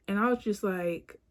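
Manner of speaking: ordinary talk, nothing marked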